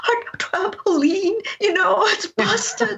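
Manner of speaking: put-on high, slow, whimpering child's voice; crying little girl. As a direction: imitate a small girl sobbing over her toy. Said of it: "the little one's crying".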